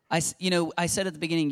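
ordinary talk, nothing marked

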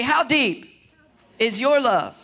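HOW and YOUR stressed, each phrase falling; model phrase for repeating. Shown as a demonstration. Pastor giving the congregation a line to say to neighbors. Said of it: "look at your neighbor and say".

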